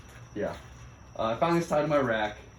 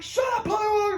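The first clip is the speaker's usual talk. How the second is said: high, strained mock yell; shouted put-down. Hazers silencing an overeager recruit, high shouted put-down. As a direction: snap back high and forceful at the rookie.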